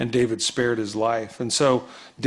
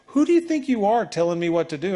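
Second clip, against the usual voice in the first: higher, huffy mock outrage; mock indignant; 7 semitones higher, 3 dB louder than usual. Preacher acts a volunteer refusing a chore, mock indignant. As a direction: play the offended volunteer, hammed-up. Volunteer balks at given task.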